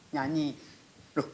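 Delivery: ordinary talk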